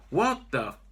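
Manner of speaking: loud shocked outburst; startled. Acted. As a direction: blurt it out in shock. Startled by gunshot.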